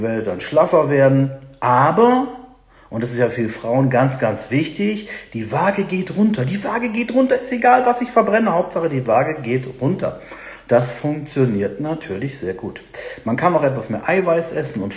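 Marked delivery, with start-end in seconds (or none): 5.3-10.2 high mock-excited dieter voice; imitating weight-obsessed women. Coach mimicking women who only care about the scale, high mocking voice. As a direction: parody a dieter in a raised, insistent pitch.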